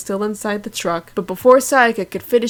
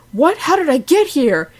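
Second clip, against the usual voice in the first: high, loud shocked question; 7 semitones higher, 5 dB louder than usual. Acted. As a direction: sound stunned and confused. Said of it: "Kyoko was shocked and said".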